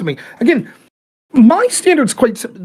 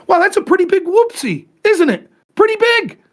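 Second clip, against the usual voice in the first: higher, mocking "pretty big whoopsie"; sarcastic; 7 semitones higher than usual. Mocking a war blunder to a cohost, higher sarcastic. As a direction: sneer at the blunder, pitch climbing.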